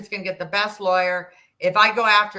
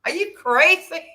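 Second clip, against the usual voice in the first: incredulous retort; 2 semitones higher, 4 dB louder than usual. Retold or imitated. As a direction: scoff at the absurd idea. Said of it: "And I said".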